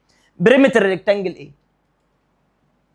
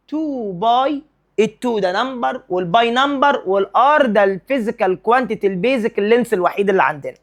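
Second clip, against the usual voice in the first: ordinary delivery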